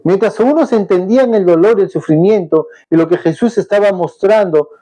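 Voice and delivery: normal talking voice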